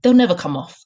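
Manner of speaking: loud, dismissive retort; shouting back. A narrator's reading. Headmistress rebuffing the worried teacher, shouting back. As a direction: throw it back forcefully. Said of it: "the Trunchbull shouted back".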